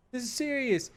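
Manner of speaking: high-pitched whining plea, slow; whiny pleading. Acted. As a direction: whine at them to stop like a hurt kid. Officer whining again.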